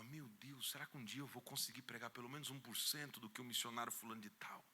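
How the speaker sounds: soft, hushed musing to himself; dreamy hushed longing; wistful inner wonder. Retold or imitated. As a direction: marvel quietly at the preacher. Young convert marvelling to himself.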